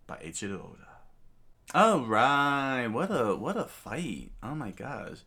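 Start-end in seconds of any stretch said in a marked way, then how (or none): 1.7-5.2 higher, louder, delighted reaction; thrilled by the fight; excited. Real, not acted. Excited reaction after story.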